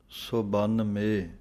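ਸੋ ਬੰਨ ਮੇ with three separate parts, slowly; ਸੋ ਬੰਨ ਮੇ, unhurried, each part spoken on its own; separated syllables. Shown as a demonstration. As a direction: keep ਸੋ, ਬੰਨ and ਮੇ apart. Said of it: "ਸੱਸੇ ਔਂਕੜ ਵੱਖਰੀ ਬੋਲਣੀ ਹੈ".

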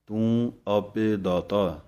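slow deliberate ਤੂੰ ਆਪੇ ਦਾਤਾ, then a short pause; pause after phrase. Shown as a demonstration. Teacher showing students the pause in ਤੂੰ ਆਪੇ ਦਾਤਾ, slow. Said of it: "ਇੱਥੇ ਜਮਕ ਹੈ।".

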